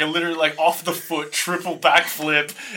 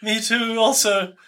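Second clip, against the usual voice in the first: higher, hesitant worried little voice; acted scared; 8 semitones higher, slower than usual. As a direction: play a nervous character, stumbling high. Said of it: "he just seems really scared".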